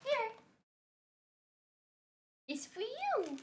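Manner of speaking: high, soft coaxing voice; tiny high voice, away from the mic; sweet pet talk. Real, not acted. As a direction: coax a pet with a treat, softly and high. Offering a pet treats.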